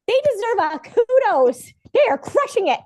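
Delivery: high, loud gushing praise; mock praise. Friend gushing over shame's performance, high and loud. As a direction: gush over them like a fan.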